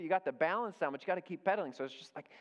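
ordinary talk

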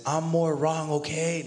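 high, loud mock argument voice; squeaky, loud parody of someone giving in; mock arguer. Pastor mocking an absurd argument.

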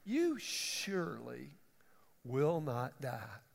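slow, hushed, sinister serpent voice; "You surely will not die" drawn out slowly and low, like a tempting snake; a lightly voiced serpent coaxing Eve slowly; sly serpent. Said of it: "As the hiss of the serpent".

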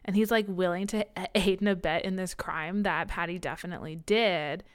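usual speaking voice

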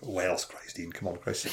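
low, soft grumble to himself; imitating a man telling himself off; muttered self-reproach. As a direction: mutter it under your breath. Man scolding himself for crying.

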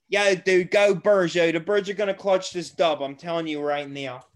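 joking Philly bro. Retold or imitated. Friends joke about the Eagles in a Philly bro accent. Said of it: "we talk like that the whole night as a joke".

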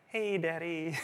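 put-on high, soft little baby voice; a light imitation of a tiny girl; baby daughter. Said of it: "she looks at me like".

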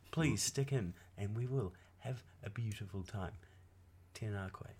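soft, gentle mock storyteller; lightly voiced bedtime story teller. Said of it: "That's what you sound like, Rian".

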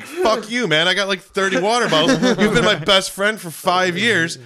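high, loud mock outrage at his best friend; mock outraged yell. Friend asks to borrow a bottle, he yells back in mock outrage. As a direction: play up the betrayal, yelling.

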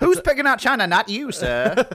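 put-on high old-timey housewife voice; a vintage ad announcer, up high. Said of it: "anytime that voice starts".

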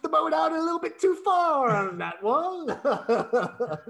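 high, hammed-up seafarer voice; silly put-on voice. Co-host running away with a boating joke, silly put-on voice. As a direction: play a jokey sailor, pitched right up. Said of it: "I love your voices".

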